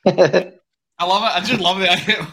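high, loud burst of delight; real delight. Real, not acted. Host reacting to guest picking week one, real delight. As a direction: crow it with relish, laughing around it.

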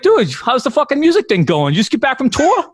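high, excited Boston townie; hammed-up Boston bar-buddy voice; imitated Boston barfly. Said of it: "he's like".

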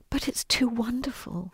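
soft whispered gasp of wonder; awed whisper. Retold or imitated. Orphan girl after the resurrection story, whispering in awe. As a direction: breathe it out in amazement. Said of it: "she went".

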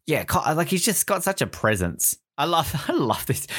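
usual speaking voice

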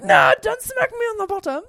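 high, loud playful protest; hammed-up scream of protest; mock scream. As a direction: squeal it in mock outrage. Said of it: "I scream and I'm like".

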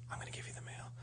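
imitating the mailman in a near-silent whisper; hushed, voiceless whisper; whispered quote. Mailman to two panicking brothers, whispered. Acting out the mailman's deadpan reply.

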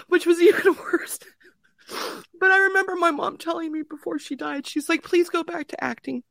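high, tearful voice; choked with grief, apologizing at the end; crying. Real, not acted. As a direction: push the memory out through real sobs. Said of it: "oh, I'm gonna start crying".

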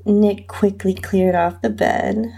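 usual speaking voice

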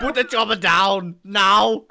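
loud, high, slow mock command; action-hero catchphrase. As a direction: bellow it like a hammed-up action hero. Joke Arnold catchphrase in film voice.

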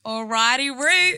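mock Australian Alrighty Roo, the Roo high and loud; chirpy Australian Alrighty Roo, Roo rising high; Australian catchphrase. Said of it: "she always says this word".

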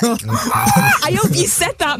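high, slow, laughing through it. Real, not acted. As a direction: laugh out the words in delight. Cracking up at Mickey's story.

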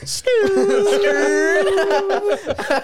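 very high, loud drawn-out "skrrr" squeal; imitated screeching noise. Imitating the noise of marchers in the snow, high screech. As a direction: screech out a comic background sound effect. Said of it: "all you can hear in the background is".

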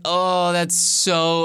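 retold frustration, words dragged out; frustrated. Speaker reacting to Caroline's admission, mildly exasperated. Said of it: "I was so frustrated".

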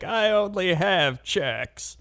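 high, slow, put-on old lady voice. Old lady tells the cashier, high and slow. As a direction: whine it like a helpless old lady. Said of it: "And she's like".

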